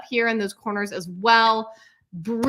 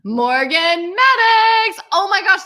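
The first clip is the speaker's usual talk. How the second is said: slow name called higher and louder, then a gasp of delight; excited announcement; 8 semitones higher, 10 dB louder, slower than usual. Real, not acted. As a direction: gush over the name.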